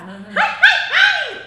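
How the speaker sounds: loud hi-hi call, leaping high; mom's call. Retold or imitated. Imitating mom calling the family, loud high call. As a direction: throw three loud calls into the distance. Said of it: "So that's my mom's voice".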